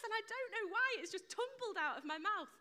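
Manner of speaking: high-pitched comic panic at a slip; squeaky, appalled self-correction; mock horror. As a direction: correct yourself in flustered disbelief. Retold horror at own slip.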